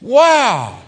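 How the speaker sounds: stunned wow; shocked wow. Retold or imitated. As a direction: gasp it out in awe. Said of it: "He was shocked, like".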